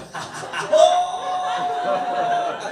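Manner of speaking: high shrieking cackle; shrieking laugh. Real, not acted. Comic cracking up at own bit, high shrieking laugh.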